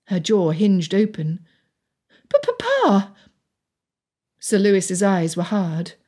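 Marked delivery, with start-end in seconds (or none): 2.3-3.1 very high-pitched dismay; aghast daughter's high cry; shocked protest. A narrator's reading. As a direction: protest to your father, voice leaping up high.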